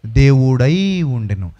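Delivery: very slow, low and loud, word by word; low, slow, ringing pulpit reading; solemn scripture intoning. Acted. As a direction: drag each word out in deep reverence. Echoes verse ending.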